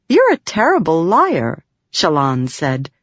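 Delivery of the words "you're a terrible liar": higher, a touch of surprise; surprised realization. A narrator's reading. Shallan realizing the spren can't lie well, surprised. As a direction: it dawns on her as she says it. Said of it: "surprised at the realization".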